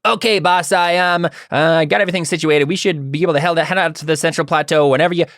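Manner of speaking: high, quick-talking henchman voice; eager high-voiced lackey; Jack. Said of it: "the, the Jack says".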